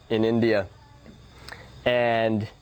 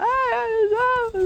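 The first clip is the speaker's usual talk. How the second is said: very high giddy squealing; excited party squeals; slower than usual. Retold or imitated. Social butterfly out partying, very high excited squeals. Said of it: "be like".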